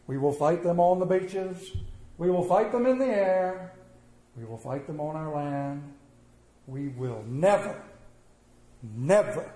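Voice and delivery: preacher quoting the wartime speech; Churchill quote. Retold or imitated. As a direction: hammer each never with a pause. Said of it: "Winston Churchill said".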